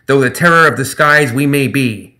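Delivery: high, loud, grandiose gremlin speech; pompous gremlin captain. Acted. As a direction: declare terms like an honorable buccaneer. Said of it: "Zip said".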